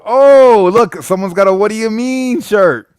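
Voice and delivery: loud, high delighted squeal; thrilled at spotting the shirt; excited. Real, not acted. Host spotting a viewer's "What do you mean?" shirt, loud and excited.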